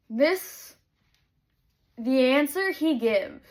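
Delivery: normal talking voice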